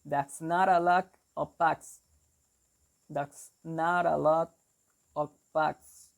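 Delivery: New York demo, BUCKS fronted to sound like 'backs'; New York accent. Shown as a demonstration. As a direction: show learners the New York vowel, 'bucks' as 'backs'. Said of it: "in New York, they will say something like".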